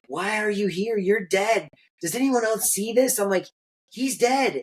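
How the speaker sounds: retelling the shock of seeing dead grandpa, voice climbing high; freaking out. Retold or imitated.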